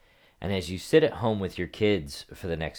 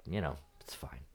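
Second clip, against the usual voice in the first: a calm private whisper; 9 dB quieter than usual. Retold or imitated. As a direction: whisper it to yourself, unbothered. Retelling inner calm.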